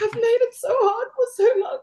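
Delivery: choked-up, high, crying voice; crying while laughing a little; real tearful crying. Real, not acted. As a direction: speak through real tears of relief. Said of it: "I'm crying because you're crying".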